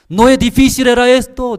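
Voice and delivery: high, loud imitation of the pastor's insistence; mock pastor teaching. Imitating the pastor repeating to the seminarians, loud and high. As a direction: hammer it home like the old pastor.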